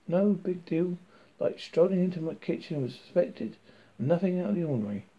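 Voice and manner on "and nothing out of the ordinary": ordinary delivery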